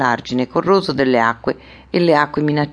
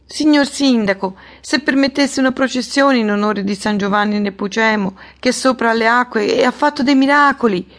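high, insistent women's voice; high-pitched supplication; pleading village women; 8 semitones higher than usual. A narrator's reading.

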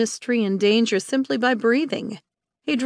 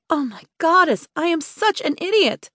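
exasperated exclamation; 6 semitones higher than usual. Acted. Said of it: "the exclamation".

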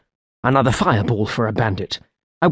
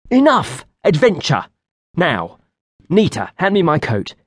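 bossy call; 6 semitones higher than usual. Acted. Commanding companion.

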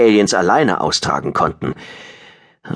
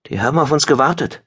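alarmed observation; 8 semitones higher, faster than usual. A narrator's reading. Pilot spots an ambush.

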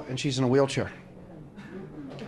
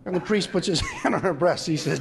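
ordinary talk, nothing marked

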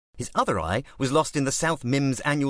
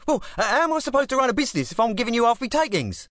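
much higher, fast East London protest; 14 semitones higher, 3 dB louder, faster than usual. A narrator's reading. Club boss protesting gangster's demand, East London protest. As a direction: plead in East London, exasperated.

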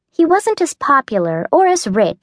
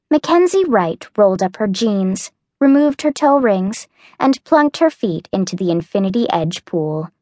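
ordinary delivery, nothing marked

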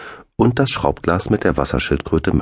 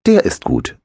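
lit up; 3 dB louder than usual. A narrator's reading. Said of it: "leuchtete Arnes Gesicht auf".

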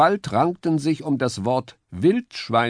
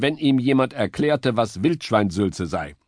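plain reading voice

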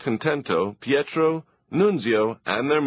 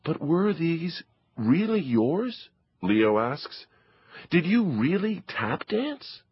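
put-on curious boy; 7 semitones higher, slower than usual. Leo asks Papa.

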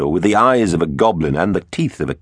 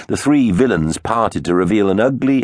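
ordinary delivery

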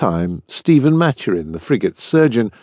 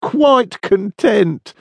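high, drawn-out, amused assent; laughing; 9 semitones higher, much slower than usual. A narrator's reading. Anderson assures the clerk he is content, laughing. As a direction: answer high and slow, full of amusement. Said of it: "said Anderson, laughing".

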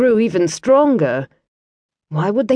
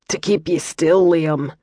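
light Scottish burr, calm and kindly; Scottish monk; 3 semitones lower than usual. Acted. Brother Matthew explains to Liam in a mild Scottish accent. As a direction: explain gently as a Scots monk.

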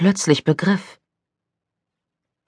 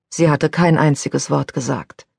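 plain reading voice

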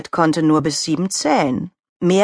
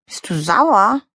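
higher, puzzled asking; confused question; 5 semitones higher, slower than usual. Acted. Donald Rabbit asking his friend, confused. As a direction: ask it as if baffled. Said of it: "fragte er verwirrt".

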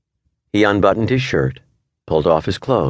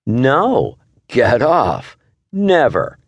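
much higher, squeaky playful mockery; mock fly voice; 8 semitones higher, 3 dB louder, much slower than usual. A narrator's reading. Wife mocks husband's get off, silly high playful voice. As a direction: echo him in a silly high insect voice.

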